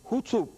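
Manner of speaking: "Hutsup" said as one clipped word, high then falling; "Hutsup" in a broad northern English imitation, falling hard at the end; Yorkshire "Hutsup". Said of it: "in his part of England, that was a similar way to their speaking".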